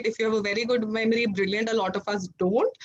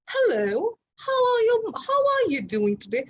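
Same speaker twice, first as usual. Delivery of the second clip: put-on posh British accent, high and sing-song; mock Queen of England greeting, light British accent; 11 semitones higher than usual. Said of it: "pretend like you're, uh, the Queen of England".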